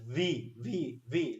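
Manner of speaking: V said as a heavy, bh-like sound; Bengali-style mispronunciation. Shown as a demonstration. Teacher shows class how Bengali people call V, mispronounced. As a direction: imitate Bengali speakers missaying the letter V. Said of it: "even some Bengali people".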